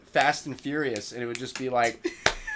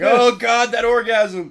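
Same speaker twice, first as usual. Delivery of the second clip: loud, high, hammed-up longing; mock craving; 6 semitones higher, 7 dB louder, slower than usual. Man staring at the dangled carrot, hammed-up longing. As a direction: cry out as if tantalized.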